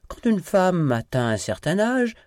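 playfully provoke a lover; teasing. A narrator's reading. Lovers teasing each other in bed. (taquin, voix plus haute et plus forte)

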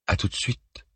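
whispered into a dead line; barely breathed after the hang-up; faint unvoiced reply. A narrator's reading. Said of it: "pas entendu sa réponse".

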